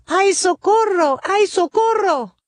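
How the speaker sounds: high panicked cries for help; crying for help. Acted. Campesino fleeing the hungry snake, crying for help. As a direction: yelp for help while running away. Farmer chased again.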